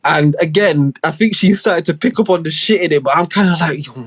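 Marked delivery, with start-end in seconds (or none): 3.9-3.9 drawn-out mock plea; pleading son. Son retelling how he begged his mum, pleading. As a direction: whine at mum to relent, acted out.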